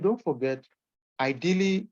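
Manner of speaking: ordinary talk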